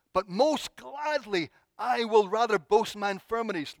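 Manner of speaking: high, wholehearted declaration; eager, emphatic quoting of Paul; most gladly. Retold or imitated. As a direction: draw out "most gladly" without any reticence. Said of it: "not a case of, well, with reticence".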